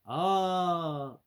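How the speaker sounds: UP drawn out, high and loud; rising volume. Shown as a demonstration. Vlogger mimicking description volume jumping, loud drawn-out UP. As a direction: swell loudly on a long UP. Voices volume shooting up on the word.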